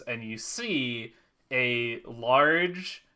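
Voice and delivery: normal talking voice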